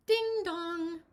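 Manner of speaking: plain reading voice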